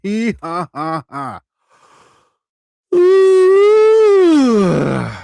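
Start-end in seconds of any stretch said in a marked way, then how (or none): 2.9-5.1 excited ooh. Real, not acted. Startled by something on stream.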